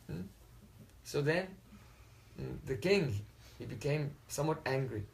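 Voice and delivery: ordinary delivery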